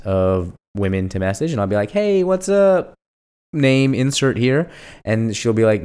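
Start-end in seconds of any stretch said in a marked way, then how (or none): 1.9-4.7 high, drawn-out "hey, what's up"; singsong, slow opener, pitched up; flirty texted greeting. Acted. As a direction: stretch out a put-on casual hello. Acts out his texted greeting.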